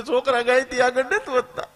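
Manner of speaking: high mock whine, laughing through it; crying teacher. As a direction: wail it like the fed-up teacher. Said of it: "teacher રોતા રોતા આવ્યો". (ફરિયાદ કરતી શિક્ષિકાનો ઊંચો, રોતલ અવાજ)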